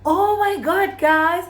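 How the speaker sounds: suddenly high and loud realization; excited outburst. Real, not acted. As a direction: burst out with the discovery.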